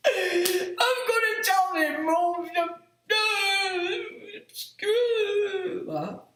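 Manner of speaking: put-on high, slow, laughing little-kid voice; laughing mock tattle-tale; mock childish threat. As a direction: play a tattling child, then drop it. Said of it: "I'm like thinking".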